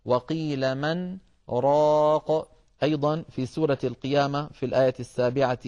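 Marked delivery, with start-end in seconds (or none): 0.1-2.5 a brief silent pause after من, then راق, slowly chanted; slow Quran recitation. Shown as a demonstration. Teacher demonstrating the pause on من, slow recitation. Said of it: "على النون من كلمة من".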